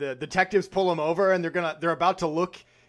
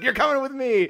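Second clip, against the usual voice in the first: higher, louder gruff sheriff order; hammed-up arrest line; mock sheriff; 7 semitones higher, 5 dB louder than usual. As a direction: bark it like a cartoon lawman. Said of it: "he's like".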